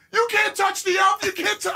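high, shouted child impression; frantic mock shriek to stop him; panicked kids. Kids stopping dad on the chair, mock panic.

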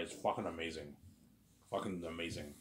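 ordinary talk, nothing marked

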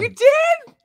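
high, loud delighted squeal of disbelief; real surprise. Real, not acted. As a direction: burst out in thrilled astonishment. Host reacts to directors' news.

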